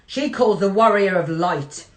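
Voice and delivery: ordinary talk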